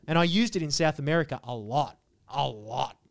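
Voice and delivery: ordinary delivery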